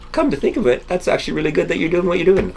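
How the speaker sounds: put-on high-pitched, quick old lady voice; imitating an older lady at the gas station. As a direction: squeak it out like a pleasantly surprised older lady. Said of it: "That's not my old lady voice".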